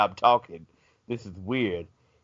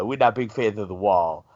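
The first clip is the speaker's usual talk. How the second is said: normal talking voice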